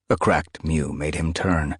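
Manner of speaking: ordinary delivery, nothing marked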